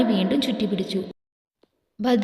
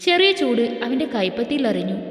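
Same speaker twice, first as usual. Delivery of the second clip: ordinary delivery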